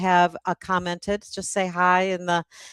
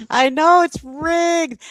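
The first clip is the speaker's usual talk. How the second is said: higher, louder, slow playful mock shout; mock outrage; 8 semitones higher, 6 dB louder, slower than usual. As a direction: play up the fake indignation. Host plays along with the rigged joke.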